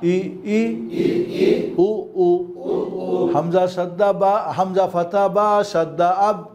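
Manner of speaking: slow, measured sing-song recitation; Qaida spelling drill. Shown as a demonstration. Teacher leads learners through Arabic spelling, slow chanted drill. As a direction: chant each letter and vowel, drawn out for repeating.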